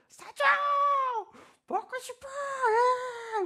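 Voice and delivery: very high, crying tantrum; whining child. Retold or imitated. Mock child crying to mom for food, very high. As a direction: wail for a treat like a toddler. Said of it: "우는 것보다".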